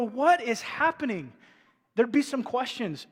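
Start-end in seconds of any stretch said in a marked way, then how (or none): 0.0-1.3 raised, drawn-out disbelief; mock bewilderment. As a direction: act out a baffled onlooker, higher and slower. Imagined shocked onlookers at stage intruder.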